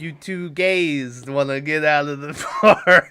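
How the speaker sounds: slow, put-on Bostonian, breaking into laughter; Boston bar heckler cracking up; Boston accent. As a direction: drag out a mock Boston accent. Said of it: "he's a Bostonian".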